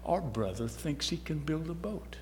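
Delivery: quietly mocking sarcasm; sarcastic. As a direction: scoff at the idea like the doubting brothers. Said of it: "they said very sarcastically".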